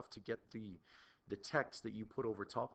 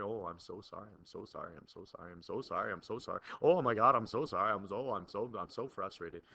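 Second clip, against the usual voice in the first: mock apologetic presenter; 2 semitones lower, 6 dB louder, faster than usual. Mocking a flustered, apologizing presenter.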